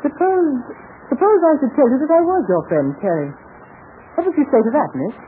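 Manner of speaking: high-pitched, put-on British accent; Johnny Samber. Terry hints at who he is, still in British accent. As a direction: keep the British disguise while teasing her. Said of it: "Terry doesn't talk like you do".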